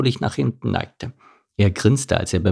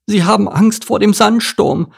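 much higher dromedary voice; Dorothee; 12 semitones higher, 4 dB louder than usual. A narrator's reading. Dromedary warns the camel about the sandstorm, high worried voice. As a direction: voice a wide-eyed dromedary, pitched way up.

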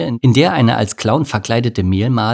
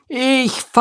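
falling scream. A narrator's reading. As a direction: scream while tumbling down. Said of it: "ihren langgezogenen Schrei".

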